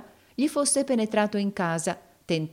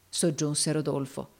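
usual speaking voice

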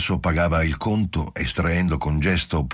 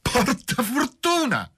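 much higher, slow giddy call; tipsy cry; slower than usual. A narrator's reading. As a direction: cheer it out drunkenly. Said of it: "poi gridò".

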